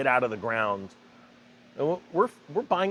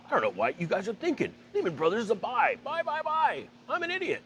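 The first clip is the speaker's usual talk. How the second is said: much higher, hyped stock-pick shouting; Jim Cramer; 7 semitones higher than usual. Retold or imitated. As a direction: pump it like a frantic TV stock picker. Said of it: "Jim Cramer is really good at that".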